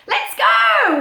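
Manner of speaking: high, loud duck voice; excited duck character; quacking duck. Acted. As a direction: quack it out eagerly. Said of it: "he quacked".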